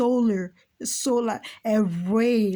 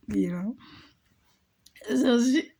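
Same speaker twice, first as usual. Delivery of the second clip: usual speaking voice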